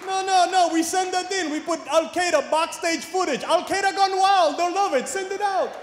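high, excited hammed-up voice; mock Al Qaeda producer. Comic playing Osama's crew, high and excited. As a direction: sell the footage like a hype man.

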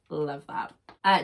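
usual speaking voice

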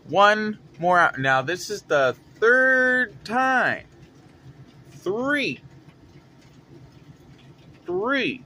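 slow, loud, high build to Three; exasperated emphasis. Acted. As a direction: stretch and punch each word. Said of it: "storyteller building exasperation".

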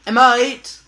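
loud, high Australian "mate"; Australian accent. Acted. Player trying out friend's Australian slang, Australian accent. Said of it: "with an Australian accent".

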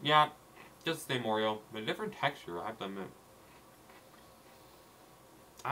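chewing while talking; eating a cookie; mouth full of cookie. Real, not acted. Seen 0: bites cookie at -4, holds piece, talks while eating afterward.

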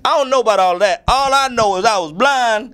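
high, animated blind-man testimony; insistent blind man. Retold or imitated. Singer as the healed blind man answering questioners, insistent. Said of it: "I'm like the blind man".

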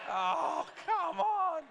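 high, loud laughing outcry; laughing protest. Real, not acted. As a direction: cry out as the joke is spoiled. Slide shown before punchline.

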